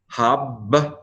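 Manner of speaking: "Habrakot" pulled apart, first syllable closed on a doubled b. Shown as a demonstration. Teacher showing a doubling dagesh, syllable by syllable. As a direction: sound it out as hab, ba, ra, kot. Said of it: "the syllable needs to close".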